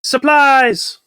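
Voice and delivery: high, loud excited cry; shouting. Pretend. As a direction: yell it out like a find. Shouting character line.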